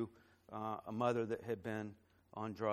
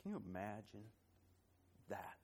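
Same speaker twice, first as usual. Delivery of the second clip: softer, holding back tears as the story lands; fighting back emotion; 3 semitones lower, 5 dB quieter than usual. Real, not acted. Pastor tells the church of friends' lost baby, softly choked up. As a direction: keep it hushed, voice close to breaking. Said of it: "gonna try to tell this story without getting emotional".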